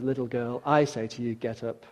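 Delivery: soft, hushed gentle call; tender command. Acted. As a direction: wake her gently. Jesus raises the dead girl.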